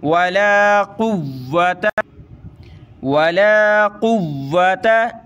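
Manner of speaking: "कुव्वता" with the double w pressed and held; hard doubled waw. Shown as a demonstration. Teacher modelling the tashdeed, "कुव्वता" with a hard held w. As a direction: chant "कुव्वता", firm on the middle consonant. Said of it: "इसको सख्त करेंगे".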